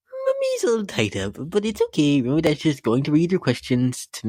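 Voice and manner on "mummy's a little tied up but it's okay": sing-song. Acted. Host tied up, sing-song.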